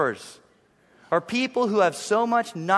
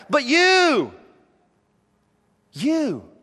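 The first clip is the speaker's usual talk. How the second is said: higher, louder ringing call; climactic cry; 8 semitones higher, 5 dB louder, much slower than usual. Acted. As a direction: throw it out to the whole room. Climax cry of Jesus' words.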